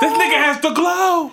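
high, slow emphatic claim. Real, not acted. Arguing Bruce Leroy wins the fight, emphatic and high. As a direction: stress that he has the glow. Heated movie debate, insisting.

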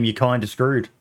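ordinary talk

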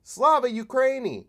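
high, loud mock patriot cheer; mocking dumb conservative. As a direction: sneer it like a gullible flag-waver. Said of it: "a dumb conservative is like".

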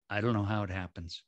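ordinary talk, nothing marked

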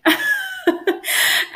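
high, bursting exasperated laugh; burst of laughter. Real, not acted. Laptop crashed mid recording.

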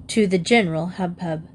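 ordinary delivery

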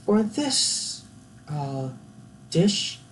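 high, halting posh British presenter; hesitant, badly faked British accent. Baking show host presenting a dish, terrible British accent. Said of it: "my British accent is terrible".

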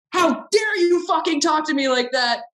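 imitating a diva's screaming fit; screaming tantrum. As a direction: rage at being spoken back to. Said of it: "like, screaming in the room".

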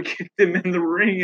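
ordinary delivery

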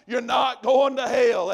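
normal talking voice

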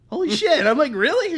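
high, loud burst of surprise; amazed disbelief. Retold or imitated. Storyteller meets his uncle's Marine buddy, amazed. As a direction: voice leaps up, astonished.

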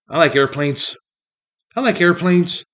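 a hint of a simple, earnest little kid; nerdy kid impression. Mocking the high school kid's answer, lightly voiced nerd. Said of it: "this is how he would say it".

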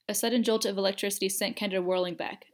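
usual speaking voice

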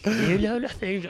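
badly faked character accent, drawn out; Ygritte accent. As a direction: try the accent slowly and quietly, not quite getting it. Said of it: "I can't do that accent".

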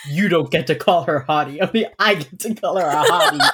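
loud, possessive half-joking rant; playful jealous fan shouting at the screen; mock jealous outburst. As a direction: claim her loudly, laughing at yourself. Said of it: "I literally was like".

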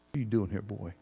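soft, low, gentle question; still small voice. Pretend. God speaks gently to us in the cave, soft and low. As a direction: ask it almost under the breath, tenderly. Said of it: "a still small voice".

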